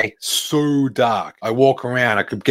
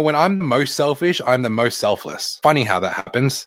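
normal talking voice

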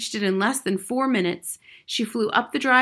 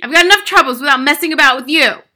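loud, quick, irritated father; snapping; 6 semitones higher, 14 dB louder, faster than usual. A narrator's reading. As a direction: rant impatiently at the child. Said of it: "the father snapped".

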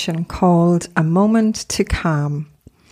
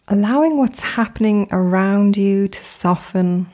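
guided meditation. Acted. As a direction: gently guide them into stillness, unhurried, phrase by phrase.

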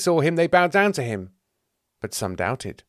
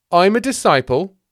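loud, high insistence; raised, protesting claim; forgotten disciple; 8 semitones higher, 9 dB louder than usual. Acted. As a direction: assert your place, affronted.

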